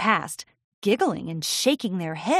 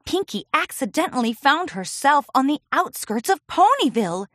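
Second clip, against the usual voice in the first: ordinary delivery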